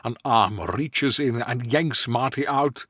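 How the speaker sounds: usual speaking voice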